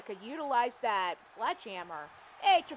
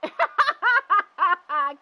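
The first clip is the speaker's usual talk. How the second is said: loud laugh; 8 semitones higher, 10 dB louder than usual. Real, not acted. Laughing at force choke joke.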